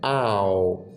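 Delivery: slow आओ gliding from आ to ओ; diphthong demo. Shown as a demonstration. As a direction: stretch आओ and glide across. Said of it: "ये इससे इधर glide करना है". (आओ को धीरे से आ से ओ तक ग्लाइड करना)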